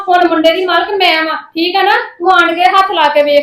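loud, defiant challenge to her sister-in-law; daring her to touch the boy; angry claim. Acted. Custody quarrel over the boy. (ਗੁੱਸੇ ਵਿੱਚ ਉੱਚੀ ਲਲਕਾਰ)

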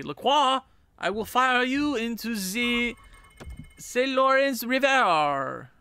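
loud mock French-accented threat; bad French accent. General manager threatening player Lacroix, in a hammed-up French accent. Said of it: "no idea how to do a French accent".

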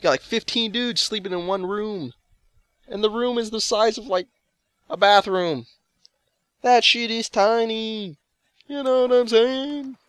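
milk the punchline like a bad Seinfeld; Jerry Seinfeld. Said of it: "That's my awful Jerry Seinfeld impression".

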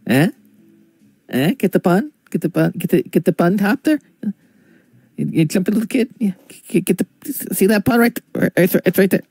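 high, stuttering, eager put-on voice; pun nudging. Joker pressing listeners to get the pun, high nagging voice. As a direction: poke at the joke in high bursts.

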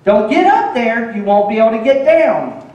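high, loud urgent call across the playground; loud, high warning shout to a small son. Retold or imitated. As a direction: call out to a child climbing too high. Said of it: "I remember calling out".

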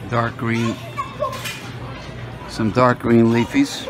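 usual speaking voice

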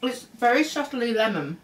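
talking while eating chocolate; muffled through fingers; chewing with hand over mouth. Real, not acted. Seen 0: Woman chews, repeatedly covering mouth with hand while talking about chocolate.

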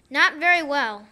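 ordinary talk, nothing marked

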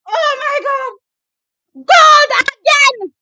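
real high, drawn-out victory scream; huge, loud, elated cheer; celebratory shout. Real, not acted. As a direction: shout it slowly at the top of your voice. Celebrating a gold medal win.

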